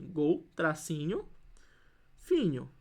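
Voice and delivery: "Gol, tracinho, finho" slowly, piece by piece; word split by hyphen. Shown as a demonstration. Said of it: "eu vou colocar só o tracinho".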